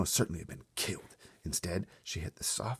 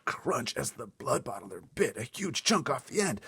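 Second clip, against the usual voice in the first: usual speaking voice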